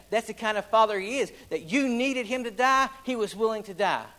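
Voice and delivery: loud, high, driving sermon delivery; fiery pulpit preaching; preaching at full force. Acted. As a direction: preach it out to the whole church, building. Preacher builds to climax.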